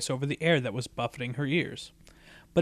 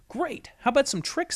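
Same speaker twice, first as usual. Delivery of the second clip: eager; 11 semitones higher than usual. Acted. Rainbow Dash eager for tricks.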